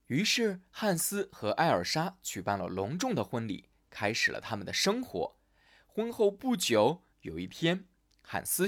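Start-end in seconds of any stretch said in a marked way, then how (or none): none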